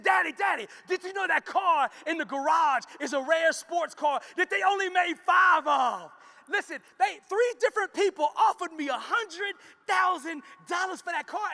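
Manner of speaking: high, loud excited shout; ecstatic daughter. Acted. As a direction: burst in with the big news. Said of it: "she comes back ecstatic".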